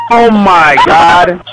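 loud, high, drawn-out cry of dismay; dismayed outcry. Real, not acted. Player who just lost everything in the game, dismayed outcry. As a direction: wail it out slowly and loudly in shock.

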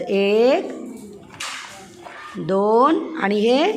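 slow, drawn-out counting with rising tone; counting demonstration. Shown as a demonstration. Teacher showing a child how to count, drawn-out counting. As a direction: stretch each number as you count. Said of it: "असं मोजायचं".

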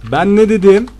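triumphant told-you-so. Real, not acted.

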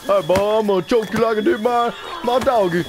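put-on hillbilly accent. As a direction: threaten playfully in a hammed-up hillbilly accent. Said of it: "I didn't mean the accent either".

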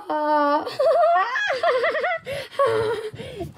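long, loud, high cackling laugh with shrieks; loud shrieking laugh. Acted. Toy cracks up at the April Fools joke, shrieking laugh. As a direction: laugh hard, almost screaming.

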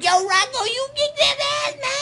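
rising, high-pitched egging-on shout; retold cheering yell. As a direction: climb into a long, high yell. Retold yell urging on a friend.

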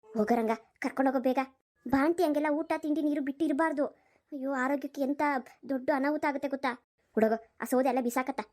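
low, brisk village aunt urging them off; an elderly aunt hurrying the young couple along; old aunt voice. Acted.

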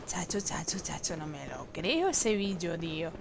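low, very soft muttering; hushed disbelief. Real, not acted. Player cannot believe that monster, hushed low muttering. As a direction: mutter it nearly in a whisper.

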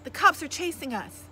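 high, loud alarmed warning; calling out. A narrator's reading. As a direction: call the danger up to the driver. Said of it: "I called".

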